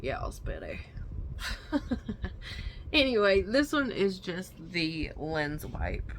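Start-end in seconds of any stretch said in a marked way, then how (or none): 0.0-0.8 put-on Deep South accent, much lower; Deep South impression. Reprises the Colonel Sanders voice. 0.8-2.9 breathy giggle at own joke; high, airy chuckle; laughing. Real, not acted.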